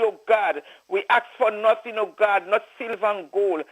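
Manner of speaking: usual speaking voice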